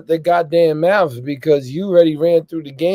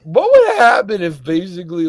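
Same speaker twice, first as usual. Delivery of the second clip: ordinary talk, nothing marked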